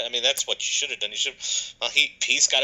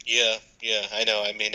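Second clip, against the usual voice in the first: ordinary talk, nothing marked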